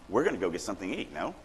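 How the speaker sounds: "no" set apart at the end with a sharp rise; questioning tag NO. Shown as a demonstration. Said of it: "they ended with a".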